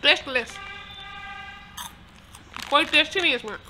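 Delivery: chewing while talking; talking around food; mouth full. Real, not acted. Seen 1: Hand to mouth at 0.8–1.1s, chewing movements while speaking.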